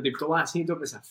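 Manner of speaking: usual speaking voice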